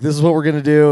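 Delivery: put-on loud, high kid giving orders; bossy kid. The boy telling his dad the plan, loud and high. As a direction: lay down the plan like an excited kid.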